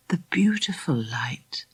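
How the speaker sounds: breathless hush, voice dropping away; whispered. A narrator's reading. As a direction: whisper it like a dream while drowning. Dreamy drowning vision, whispered.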